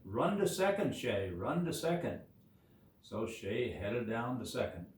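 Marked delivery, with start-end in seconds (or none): 0.0-2.2 loud call, starting high; yelling. A narrator's reading. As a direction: yell it like teammates urging a runner on. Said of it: "they started yelling".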